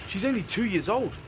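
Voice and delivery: put-on old sailor huffing at the guard; indignant. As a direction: bristle at the insult to his boat. Old man indignant about his boat.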